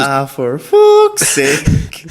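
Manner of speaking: high, very slow West Cork groan of a curse; mock West Cork exasperation. Friend imitating a farmer breaking the PTO shaft, slow high West Cork groan. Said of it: "same farmer".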